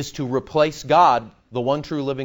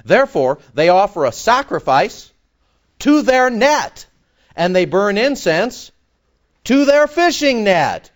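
louder, slowing, higher on the net lines; forceful scripture reading; 5 semitones higher, 7 dB louder than usual. Acted. Preacher reading Habakkuk to his congregation, preaching at full force. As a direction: proclaim it like a prophet.

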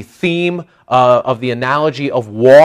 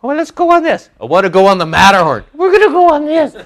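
much higher, sing-song aunt voice; imitating his aunt; 13 semitones higher, 4 dB louder, faster than usual. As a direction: chirp it like an eager aunt. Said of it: "she'd take me by the hand".

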